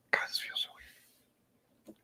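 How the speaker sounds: slow hushed whisper to herself. Real, not acted. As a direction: breathe it out under her breath. Aside while starting the tasting.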